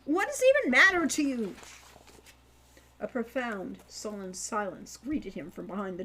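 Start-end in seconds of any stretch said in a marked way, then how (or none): 0.0-1.5 loud, high, indignant demands; frustrated boy shouting; pleading shout. Acted. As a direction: yell through the door, fed up. Keeps shouting at closed door.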